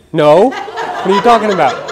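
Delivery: hammed-up puzzled family answering; baffled reply. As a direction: laugh out a confused family's denial. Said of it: "They're like".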